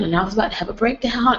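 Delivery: ordinary delivery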